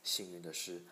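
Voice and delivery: plain reading voice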